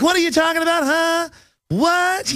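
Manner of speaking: high, mocking voice of a confused woman; high squeaky woman impression. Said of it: "They were like".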